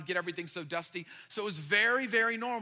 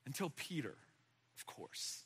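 normal talking voice

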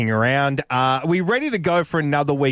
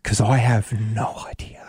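whispering; 4 semitones lower than usual. Real, not acted. Whispered aside mid-game.

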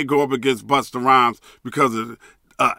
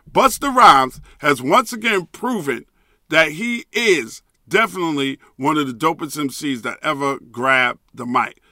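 usual speaking voice

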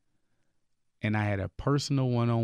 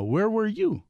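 higher, pressing question from God; retelling God's challenge in a raised voice; God's challenging voice; 11 semitones higher, slower than usual. Retold or imitated. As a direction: ask it as God would, searching and insistent. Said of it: "when he started asking you".

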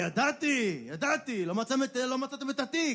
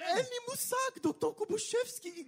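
timid clerk; 13 semitones higher, 4 dB quieter than usual. Acted. Timid clerk answers boss.

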